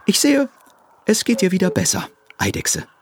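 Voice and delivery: hohe, schnelle, erleichterte Hasenstimme; erleichterter Hase. A narrator's reading. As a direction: erleichtert und zügig feststellen. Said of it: "stellte er erleichtert fest". (der Hase freut sich über die Genesung, hoch und erleichtert)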